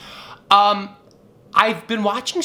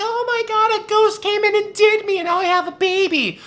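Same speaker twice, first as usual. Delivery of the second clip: much higher, hammed-up mock girlish voice; mock Mary; 14 semitones higher than usual. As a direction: ham up Mary faking innocence, pitched way up. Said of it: "She was like".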